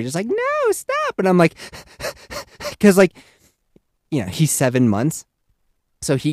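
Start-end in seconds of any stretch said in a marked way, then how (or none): 0.3-1.2 put-on high little-kid protest; high mock toddler no; toddler yelling. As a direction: squeak it like a toddler. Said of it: "baby Yeti's yelling at Yeti Junior".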